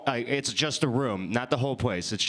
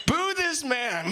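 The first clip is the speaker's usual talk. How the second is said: mock booing call; 11 semitones higher, slower than usual. Calling out audience to boo.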